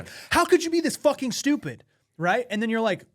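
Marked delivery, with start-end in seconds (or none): 0.3-1.8 mock furious outburst. Someone ranting about politics, a hammed-up furious yell. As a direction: pitch jumps high on an exasperated rant.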